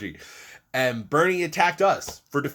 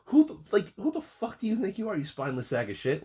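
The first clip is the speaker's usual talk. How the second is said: pitched up in outrage, then biting off the insult; a high, indignant rant; snapping at a coward; furious outburst; 8 semitones higher, faster than usual. Real, not acted. Ranting furiously at Bernie.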